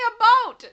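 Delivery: "a boat" said high and loud; mocking "a boat". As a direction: squeal "a boat" in mock protest. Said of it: "Kyle said we don't say".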